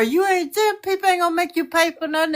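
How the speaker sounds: mother scolding her grown daughter over the phone; fussing. Real, not acted. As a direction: fuss at her like a worried mother. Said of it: "Mama Glenda ain't never fussed at me like that".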